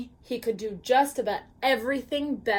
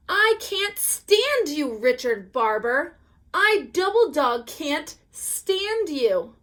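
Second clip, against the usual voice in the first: loud, high, slow angry scream; 5 semitones higher, 5 dB louder than usual. Acted. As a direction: scream it at him. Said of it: "I screamed".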